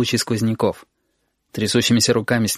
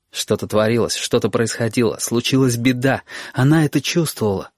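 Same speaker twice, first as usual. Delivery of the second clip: ordinary delivery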